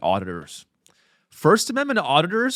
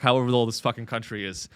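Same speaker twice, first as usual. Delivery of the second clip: ordinary delivery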